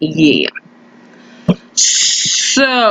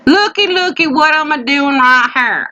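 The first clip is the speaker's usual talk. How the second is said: country drawl; 4 semitones higher than usual. Acted. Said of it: "I do my country voice way more".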